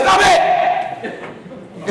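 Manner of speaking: high, loud sharp "Stop it!"; sudden shout. Retold or imitated. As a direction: startle her out of it with a yell. Counselor interrupts crying student.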